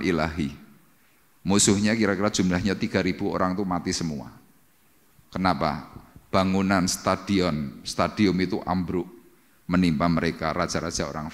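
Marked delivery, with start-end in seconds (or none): none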